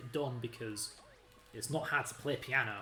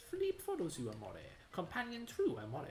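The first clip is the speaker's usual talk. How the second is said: put-on Italian accent; 3 semitones higher than usual. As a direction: swoon over every amore in a mock Italian accent. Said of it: "It has an Italian accent".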